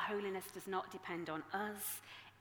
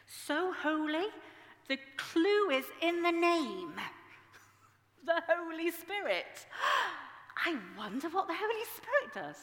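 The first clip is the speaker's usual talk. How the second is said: much higher and louder, with a gasp; mock amazement; 9 semitones higher, 7 dB louder than usual. Real, not acted. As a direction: play it as a big reveal.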